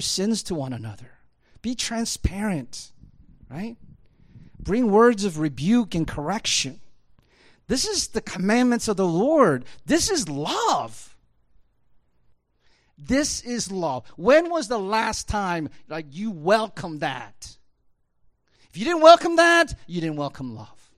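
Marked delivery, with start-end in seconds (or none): 4.6-17.6 higher, louder, rising sermon climax; full-force preaching. Acted. Pastor urging the congregation to welcome rebuke, preaching hard. 18.7-19.7 a sudden high shout; shouted peak. Acted. Pastor shouting a warning at the congregation, loud and high. 19.9-20.9 higher, louder, rising sermon climax; full-force preaching. Acted. Pastor urging the congregation to welcome rebuke, preaching hard.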